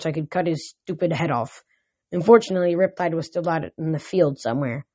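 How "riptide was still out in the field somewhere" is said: ordinary delivery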